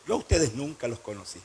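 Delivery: low, soft, final rejection; voice of God. Acted. The Lord answers the hypocrites, low quiet divine verdict. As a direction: deliver the verdict deep and quiet. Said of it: "Y el Señor les va a decir".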